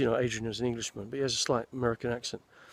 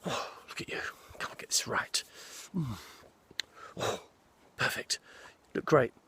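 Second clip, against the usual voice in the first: imitating the dresser. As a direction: fuss over the actor like the wardrobe man. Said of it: "He was very much like".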